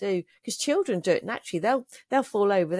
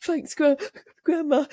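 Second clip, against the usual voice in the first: high, louder mock child voice; put-on kiddie voice, pitched way up; grandchild's reply; 9 semitones higher, 4 dB louder than usual. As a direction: imitate a small child answering Grandma. Voicing grandchild's reply to her.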